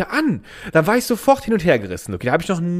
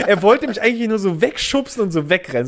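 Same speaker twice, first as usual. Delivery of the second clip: normal talking voice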